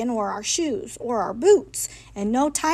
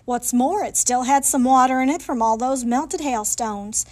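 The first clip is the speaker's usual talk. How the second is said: plain reading voice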